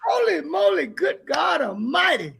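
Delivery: high, shouted exclamation of wonder; real amazement, shouted; astonished shout. Real, not acted. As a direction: cry out in amazement at seeing him. Reunion astonishment.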